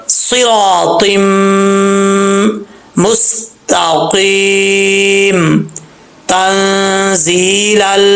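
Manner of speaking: slow, drawn-out chanted recitation; tajweed recitation; Quranic recitation. Shown as a demonstration. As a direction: recite each word long and measured. Recites the verse.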